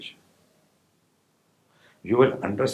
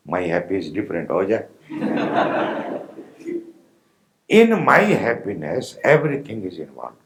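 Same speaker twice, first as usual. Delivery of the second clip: normal talking voice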